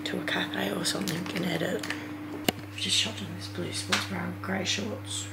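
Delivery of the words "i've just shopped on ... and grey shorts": ordinary talk, nothing marked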